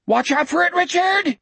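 high panicked scream. Acted. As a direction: scream a warning, high and urgent. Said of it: "Jack screamed".

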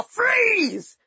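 high sharp shout of freeze; yelled command. Retold or imitated. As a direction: bark it like a cop. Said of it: "heard a man's voice yell".

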